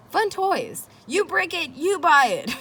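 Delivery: jokey yard-sale sign read high; mock sales pitch. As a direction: pitch the broken toys like a carnival barker. Reading out his toy table sign.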